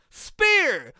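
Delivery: sudden loud, high yell; shouted call. Retold or imitated. Commentator shouting the move in a silent venue. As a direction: blurt out the move name at full volume. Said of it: "he goes".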